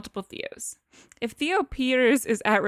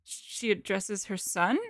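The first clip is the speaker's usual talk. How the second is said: usual speaking voice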